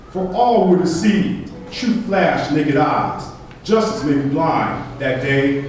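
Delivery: emphatic spoken-word delivery; forceful poem recitation. Acted. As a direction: declaim it with force to the room. Reads own poem aloud.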